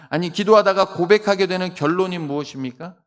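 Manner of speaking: ordinary talk, nothing marked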